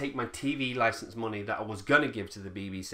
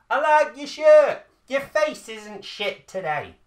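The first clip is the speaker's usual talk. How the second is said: much higher, louder, slow hammed-up squeal; silly compliment voice; 12 semitones higher, 10 dB louder, slower than usual. Cheering up a depressed neighbour, hammed-up high squeal. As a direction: ham up a goofy neighbour compliment. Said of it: "say something nice to your neighbour".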